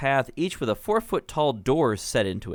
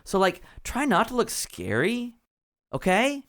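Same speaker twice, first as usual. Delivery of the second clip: put-on valley girl gnome; 9 semitones higher than usual. GM voices gnome valley-girl style.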